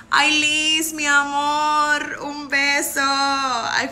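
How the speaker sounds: high, loud and slow, sending a kiss; affectionate. Real, not acted. Blowing a kiss to her friend Liz, affectionate. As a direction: coo at a dear friend.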